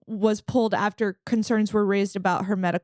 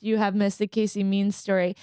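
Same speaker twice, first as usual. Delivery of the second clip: usual speaking voice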